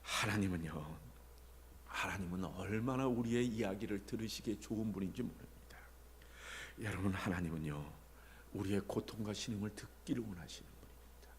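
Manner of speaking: low, soft, tender preaching; gentle reassurance. Real, not acted. Preacher tells congregation that God listens, softly reassuring. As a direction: drop right down and reassure them gently.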